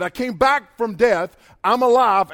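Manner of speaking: loud, slow proclamation; Jesus proclaiming. Acted. Jesus declaring his victory over death, loud and slow. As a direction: boom it out like a risen king. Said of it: "And he said".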